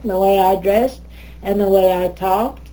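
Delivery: ordinary talk, nothing marked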